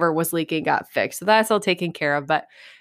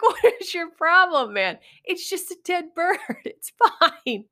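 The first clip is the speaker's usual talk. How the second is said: mock exasperation; 9 semitones higher than usual. Mock-scolding squeamish friend.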